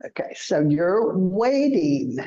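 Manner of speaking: ordinary talk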